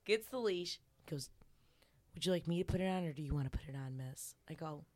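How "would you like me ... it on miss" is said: low, soft, rapid deferential question; submissive client. Retold or imitated. Client asking his mistress about the leash, quietly deferential. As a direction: imitate a meek servant, quick and hushed.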